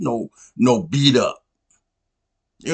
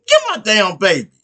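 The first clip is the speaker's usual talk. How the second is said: mama's mock demand; 6 semitones higher, 4 dB louder than usual. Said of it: "so the mama was like".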